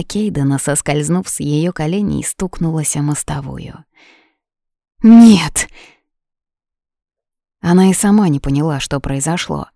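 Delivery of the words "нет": loud, high desperate cry; scream. A narrator's reading. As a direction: cry out in despair. Yuna screams seeing head fall.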